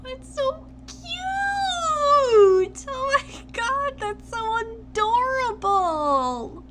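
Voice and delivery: high-pitched gushing squeal; squealing excitement. Real, not acted. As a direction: squeal it, overwhelmed by cuteness. Gushing over cute photo.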